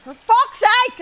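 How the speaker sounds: high, loud burst of exasperation; sudden high-pitched exasperated curse; frustrated shout. Real, not acted. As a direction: yell it, totally fed up. Frustrated at finding no clue.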